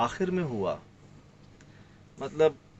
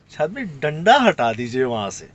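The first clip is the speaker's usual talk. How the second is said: louder, pressing plea to move the stick; retold urgent warning, a little louder; 5 dB louder than usual. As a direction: urge him to take the stick away. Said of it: "Sohail بھائی نے مجھے دو بار کہا".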